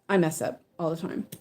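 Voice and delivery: ordinary talk, nothing marked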